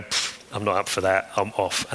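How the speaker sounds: mild mock scoff of a deserting crowd; dismissive. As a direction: shrug it off like you're leaving. Crowd walking away from Jesus.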